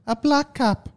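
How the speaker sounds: high mock South African voice; badly faked South African accent. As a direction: ask it in exaggerated South African. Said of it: "Remove this accent from the list".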